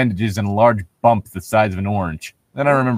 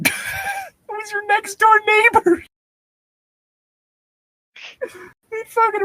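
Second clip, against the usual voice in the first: much higher, slow mocking squeak; mock it in a silly high voice; squeaky mock voice; slower than usual. Player mocking the father's answer, high and slow.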